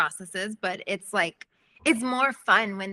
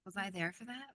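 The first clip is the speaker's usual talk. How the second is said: dazed quiet aside; 15 dB quieter than usual. Retold or imitated. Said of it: "and I'm like".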